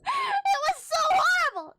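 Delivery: high, loud wail of disgust; anguished cry. Real, not acted. Retelling shocking comic, crying out.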